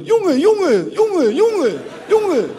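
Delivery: high street-slang "jongen" chant; imitating Moroccan Dutch street talk. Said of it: "Zo heel straat".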